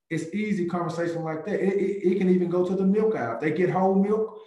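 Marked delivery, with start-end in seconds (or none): none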